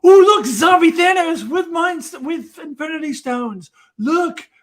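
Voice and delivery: high, loud gushing fanboy voice; hammed-up fan excitement over zombie Thanos; mock fan excitement. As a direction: gush like a dazzled fan. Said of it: "that's one of those things that is like".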